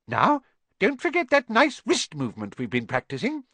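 high, squeaky little professor voice. A narrator's reading. Tiny professor coaching his class, high squeak. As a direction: squeak it out like a tiny eager teacher. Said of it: "squeaked Professor Flitwick".

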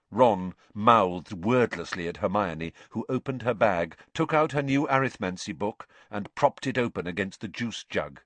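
plain reading voice

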